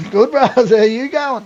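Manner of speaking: lightly voiced Aussie lad; high mock bloke voice. Hungover lad answering.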